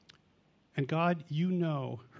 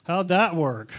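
mock voice of a failed prayer; 9 dB louder, slower than usual. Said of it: "looks at you and goes".

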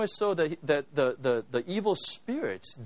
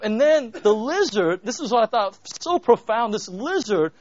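louder and higher, fervent preaching; preaching climax; 7 semitones higher, 8 dB louder than usual. Real, not acted. Preacher to congregation, fervent and loud. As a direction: drive it home with rising passion.

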